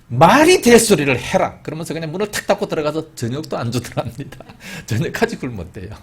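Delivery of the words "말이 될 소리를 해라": loud, sharp scolding retort; angry outburst. Retold or imitated. Wife snapping angrily at her husband's adoption idea. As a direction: snap back at the husband in anger. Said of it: "화를 막 버럭 내면서".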